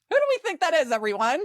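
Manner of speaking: asking the audience, pitch way up; playful quiz to the listeners; teasing question. Real, not acted.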